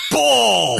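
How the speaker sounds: high, loud kick yell; shouted punchline. Acted. A wrestler kicking his opponent, yelling the punchline. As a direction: bellow it as you kick. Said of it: "and I go".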